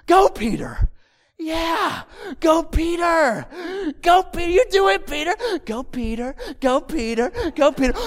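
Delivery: high, strained mock cheer; acted cheering. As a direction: cheer him on like an awed spectator. Disciples cheer Peter walking on water.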